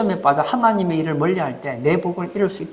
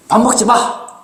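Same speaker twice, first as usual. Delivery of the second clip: acted angry shout, higher and louder; imitating angry grandfather; 5 semitones higher, 6 dB louder, slower than usual. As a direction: bark it like a cross grandfather. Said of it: "그렇게 막 화를 냈다는 거예요".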